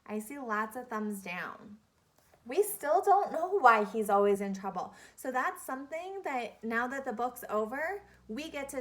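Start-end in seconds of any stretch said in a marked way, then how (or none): none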